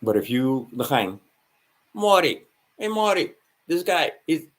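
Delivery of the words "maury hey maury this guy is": mock official. Officials mocked in retold scene.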